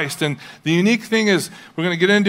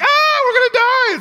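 high mock wail of doom; mock panic; 6 dB louder than usual. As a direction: squeal it like a melodramatic panicked churchgoer. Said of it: "and we're like".

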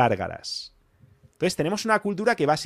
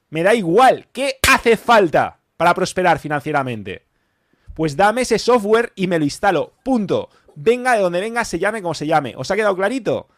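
louder and higher, hammering each point; fired-up rant; 5 semitones higher, 6 dB louder than usual. Real, not acted. Host firing up his audience about money culture, loud and excited. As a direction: preach it at the listeners, punchy and emphatic.

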